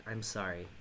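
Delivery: a hushed sheepish sorry; quiet sorry. Real, not acted. Gamer quietly apologizing to viewers for swearing, hushed.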